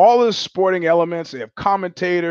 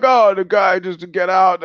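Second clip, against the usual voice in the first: lightly voiced gangster, a bit higher and louder; mobster impression; 4 semitones higher, 4 dB louder than usual. Retelling a Goodfellas scene to listeners, light gangster voice. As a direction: toss it off like a touchy wiseguy. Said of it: "And he's like".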